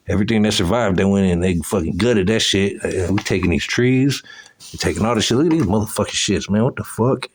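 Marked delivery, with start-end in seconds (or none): none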